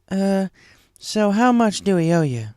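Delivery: put-on friendly farm-girl question; Applejack. A narrator's reading.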